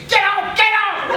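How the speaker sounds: high, slow, laughing mock scream of "Get out"; mock scream at scale. Yelling at the talking scale, a high laughing mock scream. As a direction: ham up a laughing yell at the scale. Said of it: "I was just screaming".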